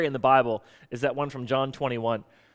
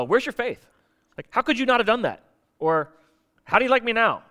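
higher, incredulous mock scolding; mock rebuke; 6 semitones higher than usual. Jesus mock-rebuking Peter, higher and incredulous. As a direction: toss the rebuke up high in disbelief.

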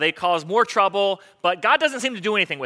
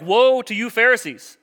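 loud, slow, higher-pitched denunciation; acted rebuke; 5 semitones higher, 7 dB louder, slower than usual. Preacher voicing Jesus denouncing Pharisees, acted rebuke. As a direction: proclaim it loudly and slowly as a rebuke.

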